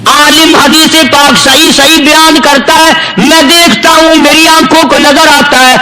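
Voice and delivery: level, sustained chant-like preaching; half-chanted sermon delivery; intoned saintly quote. Retold or imitated.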